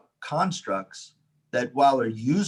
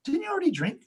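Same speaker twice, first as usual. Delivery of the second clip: pitched far up, a puzzled mock question; guide's question; faster than usual. Guide asks storyteller about drinking already, high mock surprise. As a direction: imitate the guide checking, high-voiced. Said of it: "my guide was like".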